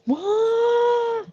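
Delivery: gasp, then a very high stunned what; real disbelief, jumping up in pitch; shocked what. Real, not acted. Guest hearing shocking news from the host, gasping in disbelief.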